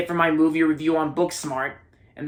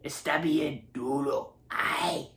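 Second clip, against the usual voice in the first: Spanish voice; 7 dB quieter, much slower than usual. Retold or imitated. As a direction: imitate the logo's Spanish voiceover, slowly. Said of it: "the Spanish voice".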